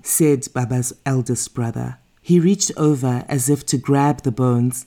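usual speaking voice

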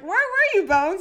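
high, loud "where were you" call; a shipful of friends calling out, high and loud; excited call. Retold or imitated. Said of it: "And everyone's like".

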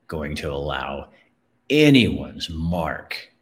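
very slow, deliberate resolve; resolute vow. Real, not acted. Speaker refuses anyone's mark on him, slow and resolute. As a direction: measure out each phrase as a firm vow. Firm personal vow to audience.

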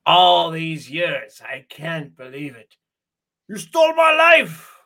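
mock French villain, high and loud; Merovingian rant. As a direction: ham up the outraged villain. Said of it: "He says".

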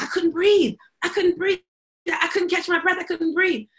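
high, breathless panic; panicked. Retold or imitated. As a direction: retell her panic, pitched high. Mother panicking after flight.

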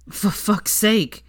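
exasperated gasp, drawn out slowly; gasped curse. A narrator's reading. Draco spitting out a mouthful of water, gasped curse. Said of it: "he gasped".

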